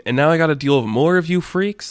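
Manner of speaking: fed-up acted whine; exasperated rant. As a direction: build in pitch to an indignant question. Character rants at the horde.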